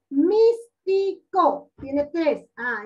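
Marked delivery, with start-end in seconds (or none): 0.1-1.7 "Místico" counted out as mís, ti, co; slow, loud "Mís-ti-co", each syllable separate; split into syllables. Shown as a demonstration. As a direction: break "Místico" into three deliberate, higher-pitched beats. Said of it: "Tiene tres sílabas".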